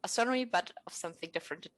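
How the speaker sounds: ordinary talk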